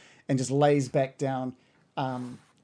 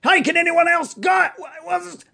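high, loud bewildered monster shouting; a big brute, frightened and lost; confused bugbear voice; 8 dB louder than usual. Acted. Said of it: "he's just like".

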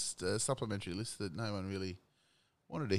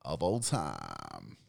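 slow, low drawn-out announcement; 8 semitones lower, much slower than usual. Acted. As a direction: stretch every word like a countdown host. Dramatic drawn-out list announcement.

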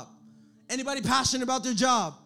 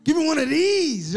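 fan cheer; 6 semitones higher, 7 dB louder than usual. Retold or imitated.

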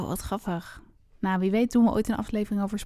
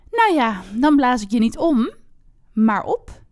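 louder storybook wolf threat; fierce wolf growl; 2 semitones higher, 8 dB louder than usual. Acted. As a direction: play the wolf's menace, pushed a little louder. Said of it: "zei wolf met woest gegrom". (gespeeld woest grommende wolf)

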